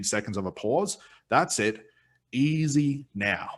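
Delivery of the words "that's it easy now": very slow, drawn-out soothing; slow soothing phrase. Shown as a demonstration. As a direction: stretch easy now out gently. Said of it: "taken a part of the sentence".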